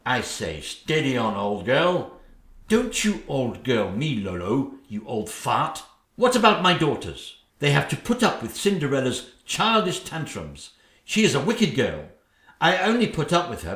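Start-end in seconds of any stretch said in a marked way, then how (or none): none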